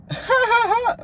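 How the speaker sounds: imitated laughing fit. Roommate answering about his sleep talking, mimicked laughing fit. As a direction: burst into a mimicked high laugh. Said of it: "He just start laughing".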